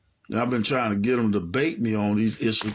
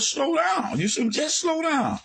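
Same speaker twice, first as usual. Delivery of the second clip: high-pitched, insistent protest; retold plea in a raised pitch; exasperated plea; 13 semitones higher than usual. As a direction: plead with a fast talker, voice way up high. Retells asking them to slow down.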